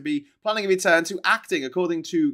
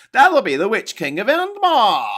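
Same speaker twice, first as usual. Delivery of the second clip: higher, louder pronouncement, a bit slow; triumphant announcement; 9 semitones higher, 11 dB louder than usual. Acted. Streamer announcing a viewer's correction triumphantly. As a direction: declare it like the final answer. Reading viewer comment boldly.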